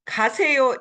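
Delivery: ordinary delivery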